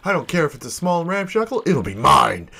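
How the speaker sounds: high, quick, hopeful character voice; dreamy would-be shopkeeper. Acted. Hopeful sailor confides dream of opening own shop, dreamy.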